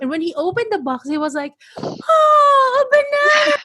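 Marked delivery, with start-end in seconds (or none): 1.8-3.6 put-on high, loud, near-tearful child voice; imitating the overjoyed kid. A kid thanking parents for a banana, high and tearful. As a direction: squeal it like a thrilled little kid.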